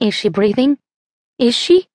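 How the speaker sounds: frantic, pitch climbing on the second ask; panicked question. Acted. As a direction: voice leaping up in panic. Becca panics over drowned Maggie.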